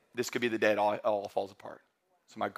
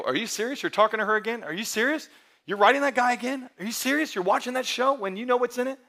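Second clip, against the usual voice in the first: incredulous accountability friend; 10 semitones higher than usual. Retold or imitated. Friend confronting you about her again, high mock disbelief. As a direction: call them out, half-joking. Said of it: "They can get in your life and go".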